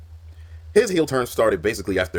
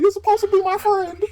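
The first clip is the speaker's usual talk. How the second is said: far higher, loud betrayed shriek; mock Batista wail; 7 dB louder than usual. Imitating Batista screaming at Rey before attacking. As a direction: screech it like a hurt friend. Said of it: "He's like".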